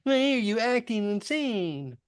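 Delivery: over-sweet pampering voice, played for mockery; mock coddling parent. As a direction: coo at the toddler sarcastically. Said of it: "cater to it and be like".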